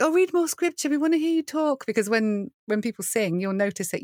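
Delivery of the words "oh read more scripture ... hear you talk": high, gushing mock plea; playfully mimicked congregation, high-voiced; imitating eager listeners. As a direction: pitch way up as a begging fan. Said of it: "they're like".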